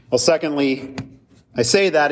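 normal talking voice

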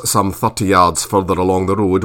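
plain reading voice

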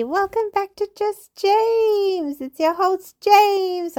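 high, slow sing-song show welcome; host greeting listeners, drawn out like a show announcer; announcer intro. Acted. Host welcoming his podcast listeners in a high, slow announcer voice.

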